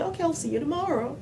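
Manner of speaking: high, run-together American "see you tomorrow"; American English, contracted "I'll", lilting high. Imitating how natives said it, high linked American accent. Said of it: "quand eux, ils le disaient".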